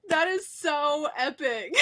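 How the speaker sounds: high laughing squeal; laughing excitedly. Real, not acted. Reacting to the winner.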